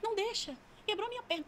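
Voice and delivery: put-on high, whimpering little-kid plea; child's voice. Demon complaining of a broken leg, high imitated child voice. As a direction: pitch it up like a hurt child. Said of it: "com uma voz de criança".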